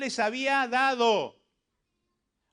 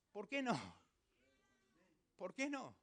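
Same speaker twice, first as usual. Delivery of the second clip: very soft rhetorical question; hushed question; 11 dB quieter than usual. Real, not acted. Preacher challenges congregation after the climax, hushed question. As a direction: drop to a near hush and let it hang.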